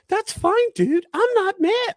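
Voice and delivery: mock him in a high whiny voice; high mocking imitation. Man mocks the driver's words in a high sneering voice. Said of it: "He said".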